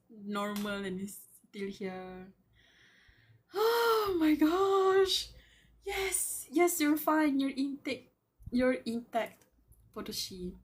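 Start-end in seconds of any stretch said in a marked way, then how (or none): 3.5-5.3 high, very slow, loud drawn-out gasp; real fan swoon; gushing. Real, not acted. Fan reacting to reunion. 5.8-9.4 high, excited comforting; eager reassurance. Real, not acted. Answering character reassuringly.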